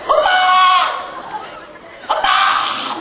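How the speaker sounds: high, drawn-out squeal for mom; calling for mom. Acted. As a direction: act a little ant hollering for its mother. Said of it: "막 자기 엄마를 부르는". (엄마를 길게 부르는 새끼 개미 흉내)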